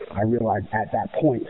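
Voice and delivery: ordinary talk, nothing marked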